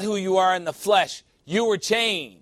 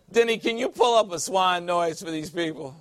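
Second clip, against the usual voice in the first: ordinary talk, nothing marked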